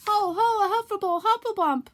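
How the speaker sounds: very high, loud garbled squeal; panicked Piglet. Acted. Piglet still running and yelling. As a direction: babble in terror.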